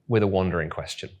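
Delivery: ordinary talk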